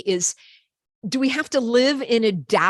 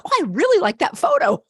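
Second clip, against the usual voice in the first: delighted; 6 semitones higher, 6 dB louder, faster than usual. Retold or imitated. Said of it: "I'm like".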